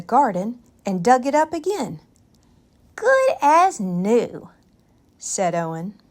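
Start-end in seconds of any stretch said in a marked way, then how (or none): 2.9-4.5 put-on high, slow, satisfied child voice; a boy proud of his trick; pleased little boy. A narrator's reading. Owen shows off his blanket, high, slow and pleased.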